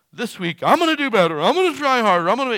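building from eager to loud and high; mock eager resolution. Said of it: "they come in the next week like".

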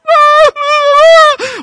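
put-on high, loud wailing cries of a small girl; imitated child wailing. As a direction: wail like a terrified little girl. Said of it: "little five-year-old girl ... crying, going".

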